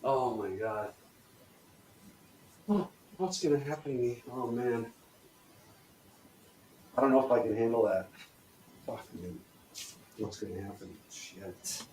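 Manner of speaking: low, soft, sinking dread; worrying. Shown as a demonstration. Coach switches back into worry, quietly anxious. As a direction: fret quietly to yourself with long pauses. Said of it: "Now we're switching back again".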